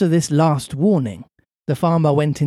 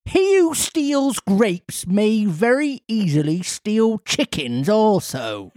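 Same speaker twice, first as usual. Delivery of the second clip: angry farmer; 6 semitones higher than usual. Acted. Farmer accusing Pinocchio of stealing chickens, angry.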